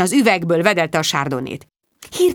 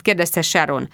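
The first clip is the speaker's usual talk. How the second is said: ordinary delivery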